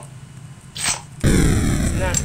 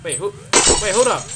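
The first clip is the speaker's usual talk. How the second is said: ordinary talk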